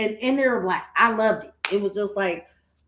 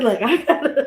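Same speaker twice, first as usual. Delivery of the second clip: laughing quote; 4 semitones higher, 4 dB louder than usual. Retold or imitated. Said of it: "I said".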